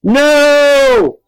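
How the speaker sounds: loud, very high, long-held yell. A narrator's reading. Child refuses and flees up the stairs, yelling. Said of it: "I yelled and ran".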